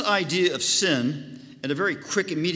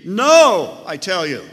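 No thrown out loud and high, then I tell you firm; Jesus flatly refusing, loudly emphatic; emphatic shout; 4 semitones higher, 12 dB louder, slower than usual. Retold or imitated. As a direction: blast the No, then press tell. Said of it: "The same way he did in verse three".

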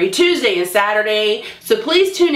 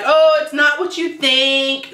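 higher, slow, drawn-out excuse; mock pleading; 6 semitones higher, slower than usual. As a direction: imitate a scammer trying to smooth things over. Mimicking scammer's backpedalling excuses.

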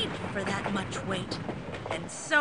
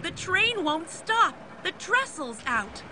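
urgent, pitched-up warning; alarmed warning; 7 semitones higher than usual. A narrator's reading. Brakemen warn everyone the trestle's out, mild alarm. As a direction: tell them the bridge is gone. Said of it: "the dino brakemen shout".

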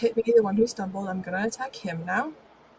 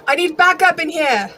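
loud, raised-pitch shout for backup; 7 semitones higher, 10 dB louder than usual. Acted. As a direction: shout it to whoever can hear. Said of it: "I shouted out".

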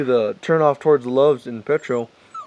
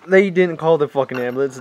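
ordinary delivery

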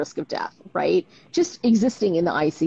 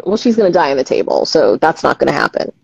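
louder, matter-of-fact surgeon, dropping low at the end; imitating a curt surgeon, louder; blunt surgeon; 3 semitones lower, 10 dB louder than usual. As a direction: deliver it like a surgeon shutting it down. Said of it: "the surgeon will go".

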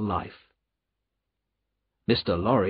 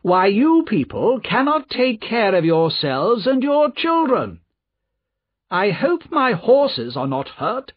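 high-pitched, haughty scolding; contemptuous nobleman in a raised pitch; cold disdain; 10 semitones higher, 4 dB louder than usual. A narrator's reading. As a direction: lecture them coldly from on high. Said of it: "he said coldly".

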